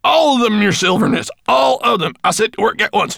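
loud, high, eager dwarf; eager cry. A narrator's reading. Gravelbeard promising Maya, loud and eager. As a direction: burst out with delight. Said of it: "he cried".

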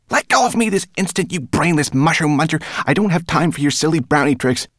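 high, loud indignant rat rant; angry hissing rat. A narrator's reading. Rat demands brownie let her go, angry. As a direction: scold your captor in a high, loud voice. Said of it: "she hissed".

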